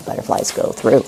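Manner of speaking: softly whispered cue; teacher whispering the next words to a child; whispered prompt. Shown as a demonstration. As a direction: breathe the words to the child as a hint.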